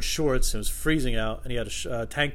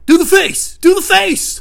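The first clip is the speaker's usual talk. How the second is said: high, loud excited shouts; hammed-up yelled demand; pestering fans; 15 dB louder, slower than usual. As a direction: badger a celebrity for his trick. Fans pester Jim Carrey.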